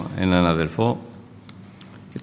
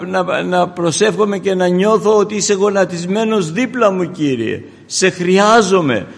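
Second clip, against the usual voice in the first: loud, higher impassioned altar call; full-force preaching prayer; 7 semitones higher, 9 dB louder than usual. Acted. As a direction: pour out the prayer over the congregation. Preacher leads congregation in prayer.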